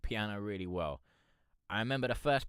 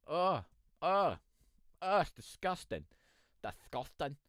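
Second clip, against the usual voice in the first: high-pitched "it's disgusting" as praise; hyped mock disgust; 8 semitones higher than usual. Real, not acted. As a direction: squeal it out like the track is too good. Hyped reaction to a song submission.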